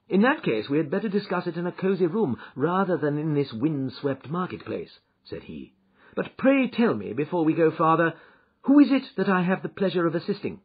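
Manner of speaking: lightly voiced courteous detective; Holmes. Holmes asks whom he is assisting, lightly voiced, courteous.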